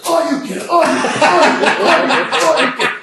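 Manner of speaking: loud, high-pitched arcade fighter shout; Street Fighter. Retold or imitated. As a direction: fire off repeated game move cries. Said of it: "played the game Street Fighter".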